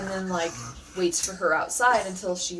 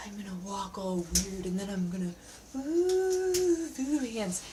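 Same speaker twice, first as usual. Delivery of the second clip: dog's inner voice; slower than usual. Retold or imitated. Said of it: "he's like".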